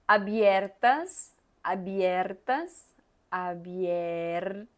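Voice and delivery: abiertas drawn out slowly, fading each time; teacher drilling abiertas. Shown as a demonstration. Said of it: "La R, ¿está bien?".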